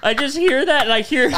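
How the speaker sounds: high, loud, breaking into laughter; laughing. Real, not acted. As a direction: laugh while telling it. Laughing retelling the crying.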